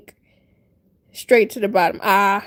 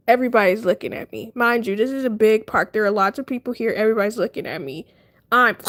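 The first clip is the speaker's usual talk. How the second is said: normal talking voice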